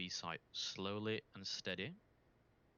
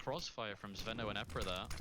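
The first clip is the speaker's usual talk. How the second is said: normal talking voice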